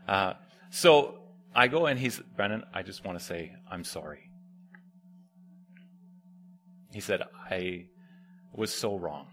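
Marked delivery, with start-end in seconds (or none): 2.4-9.3 low, hushed, humbled apology; a lightly voiced repentant teen; soft apology. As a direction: say sorry quietly, ashamed.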